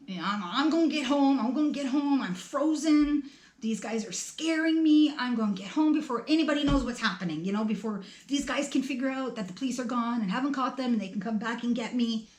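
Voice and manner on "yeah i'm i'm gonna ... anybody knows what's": frantic. Retold or imitated. Frantic thoughts while running home.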